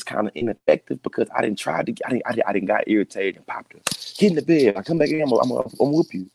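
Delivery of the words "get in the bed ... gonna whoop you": stern dad threat. Retold or imitated. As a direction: bark the order at a toddler. Dad voicing threat to daughter.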